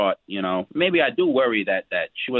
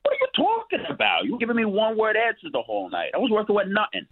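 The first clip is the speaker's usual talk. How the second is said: higher, heated protest; indignant outburst; 9 semitones higher than usual. Real, not acted. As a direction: fire back, offended and defensive. [name] snaps back at Hope.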